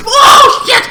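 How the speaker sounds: very high, loud startled yell; shocked shout. Retold or imitated. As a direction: jump at it in shock. Character caught off guard.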